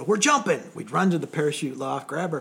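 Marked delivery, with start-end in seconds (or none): 0.0-0.6 louder, higher call across the room. Retold or imitated. Instructors calling students to the jump, loud call. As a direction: announce it to the whole class. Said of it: "and they go".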